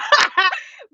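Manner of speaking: high, breaking laugh; giggling laugh. Real, not acted. Reader laughs at herself feeling herself, high laugh. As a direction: burst into a laugh.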